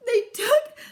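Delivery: high, loud, slow stammer near tears; upset cub stammering through tears; choked up. Acted. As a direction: sputter it out, too upset to speak. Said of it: "so angry she could hardly speak".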